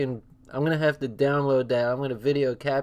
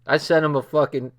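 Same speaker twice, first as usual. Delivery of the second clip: normal talking voice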